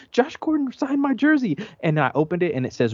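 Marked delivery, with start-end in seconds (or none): 0.1-1.6 much higher, overjoyed announcement; all-caps excitement. Retold or imitated. Fan reads out his all-caps group text, overjoyed.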